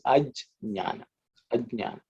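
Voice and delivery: ajnana with a distinct j before the ny sound; careful Sanskrit j-ny cluster in ajnana; purist pronunciation. Shown as a demonstration. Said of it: "as a purist".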